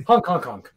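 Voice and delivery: honking like a goose; mock goose honk. Imitating goose honks.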